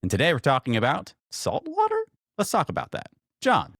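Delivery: high, loud, bright-eyed show host welcome; big announcer intro. Acted. Host welcoming listeners to the podcast, booming announcer voice. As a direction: open the show like a hyped announcer.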